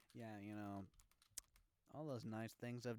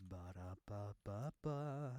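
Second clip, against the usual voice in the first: silly babbling; 2 semitones lower, much slower than usual. Pretend. Mock pufferfish noises.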